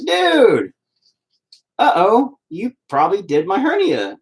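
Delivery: high, loud sudden "uh-oh" of surprise; surprised realization. Retold or imitated. Telling a stranger he did his surgery, surprised and high. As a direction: act out the shock of realizing who he's talking to. Quoting himself realizing the connection.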